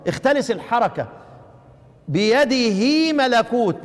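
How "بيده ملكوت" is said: بيده with the هي held long, slow; prolonged vowel. Shown as a demonstration. Teacher showing the lengthened بيده. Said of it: "انا استريحت في نطقها، مكنت الحركة".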